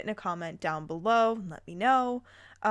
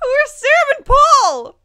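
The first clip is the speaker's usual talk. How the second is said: much higher, loud, slow disbelieving squeal; incredulous high-pitched shout; baffled shriek; 15 dB louder, slower than usual. Real, not acted. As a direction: shriek in total confusion. Reader baffled by new characters.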